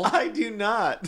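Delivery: slow, drawn-out Southern denial; Southern accent. Acted. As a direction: drag it out like a Southern belle. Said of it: "You sound like Leslie Jordan".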